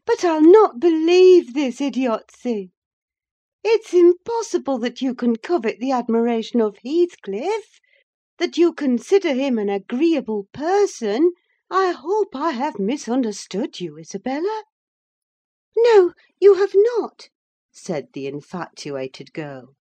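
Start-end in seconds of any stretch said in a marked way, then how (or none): 0.0-14.6 high, loud, emphatic disbelief; incredulous Catherine. Acted. Voices Catherine challenging Isabella. 15.8-17.3 high, loud, passionate protest; defiant young woman. Acted. Isabella defiantly contradicts Catherine.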